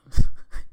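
loud breathy giggle; shy happy laugh; giddy laugh burst. Real, not acted. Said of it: "I'm smiling".

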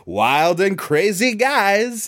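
a light hint of the wild and crazy guys' Czech accent, slow; Festrunk brothers voice. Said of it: "like one of the Festrunk brothers".

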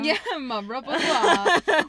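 laughing through the words. Real, not acted. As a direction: echo it while cracking up. Laughing repeat of quote.

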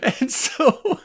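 high-pitched, struggling through real laughter; slow, giggle-broken restart; laughing. Real, not acted. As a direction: try to carry on while cracking up. Laughing while resuming mock speech.

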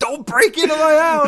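high, loud joking warning; mock shout. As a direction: holler it at imaginary burglars. Joking shout to would-be burglars.

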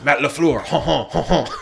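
put-on Matt LaFleur drawn out slowly in a laughing French accent; mock French accent. A mock French accent for joking about coach Matt LaFleur. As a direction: stretch the name out, Frenchified. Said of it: "French coach".

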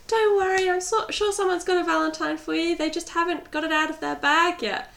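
high sugary reassurance; sweet girl voice. Retold or imitated. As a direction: play the sweet popular girl. Hot girl voiced in retold story.

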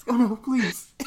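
high-pitched mock-distressed plea; imitating a helpless, begging viewer; retold pleading. Moviegoer begging the screen, high pleading. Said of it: "first time I ever made this noise".

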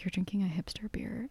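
whispering the teasing quote; hushed, breathy teasing; whispered quote. Retold or imitated. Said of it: "everyone was like".

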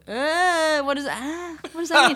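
high, loud, laughing mock confusion; imitating a baffled singer who can't read the director, high and laughing; confused singer. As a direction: play a lost, baffled singer. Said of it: "somebody else will go".

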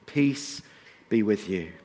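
soft, low blessing; Jesus's greeting. Retold or imitated. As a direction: offer it gently as Jesus would. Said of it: "That is a promise for us too".

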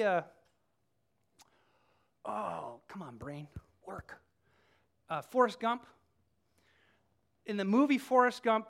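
ordinary delivery

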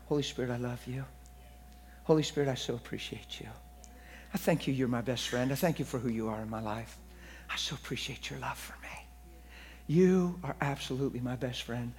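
hushed, low and tender; intimate prayer. Retold or imitated. New believer privately thanking the Holy Spirit, hushed and tender. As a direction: softly confide gratitude, almost whispering in places. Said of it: "I started talking to the Holy Spirit".

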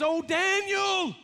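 a king calling into the den in dread; anguished cry. Acted. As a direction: cry out his name in anguish.

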